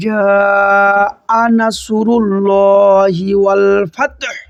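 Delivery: very slow chanted tilawat; Quran recitation. Shown as a demonstration. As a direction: recite the line slowly in tajweed. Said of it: "পুরো লাইনটা একবারে বলি". (ধীর তিলাওয়াত)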